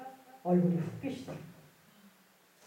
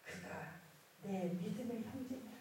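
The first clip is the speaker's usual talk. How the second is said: hushed, much softer divine pronouncement; voice of God; 16 dB quieter than usual. Retold or imitated. God reveals something astonishing to the believer, hushed voice of God. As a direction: voice God speaking very quietly. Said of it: "하나님이 기가 막힌 말씀을 하셨어요".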